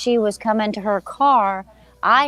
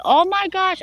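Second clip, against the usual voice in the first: high alarmed gasp of a phrase; higher, louder startled exclamation; panicked; 11 semitones higher, 4 dB louder than usual. Retold or imitated. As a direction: freak out at the frog. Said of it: "I was like".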